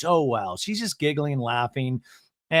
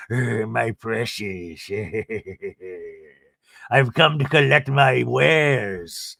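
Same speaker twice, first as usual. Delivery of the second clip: slow, creaky Gollum-like croak; hammed-up slow creature voice; hunched creature; 3 semitones lower, much slower than usual. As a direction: creep in like a hobbling goblin.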